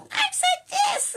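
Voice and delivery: very high, loud, drawn-out screech; mock a spoiled kid screeching a demand; bratty tantrum. Imitating a bratty kid to viewers, screeched tantrum.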